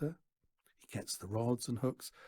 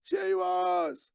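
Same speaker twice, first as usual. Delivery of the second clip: very high, loud yell; 7 dB louder than usual. A narrator's reading. Daughter yelling from inside the caravan, very high loud. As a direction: bellow it from behind the door. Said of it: "yells Natasha".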